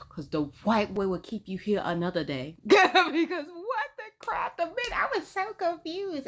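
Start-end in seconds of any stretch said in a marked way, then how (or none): 2.6-6.3 high, laughing disbelief; laughing bewilderment. Real, not acted. Reacting to the confusing date, high laughing bewilderment. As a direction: squeal it through laughter.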